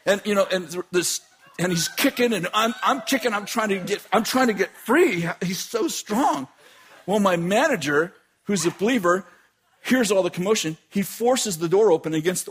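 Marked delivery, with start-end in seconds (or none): none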